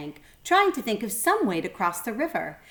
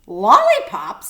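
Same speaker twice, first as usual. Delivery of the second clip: surprised crocodile; 3 semitones higher, 11 dB louder, slower than usual. Acted. Crocodile delighted at treats.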